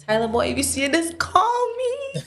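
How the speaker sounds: high, squealed playful call-out; playful plea. Pretend. Guest jokingly begs a film star on air, playful high-pitched plea. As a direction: plead in a rising, jokey high voice.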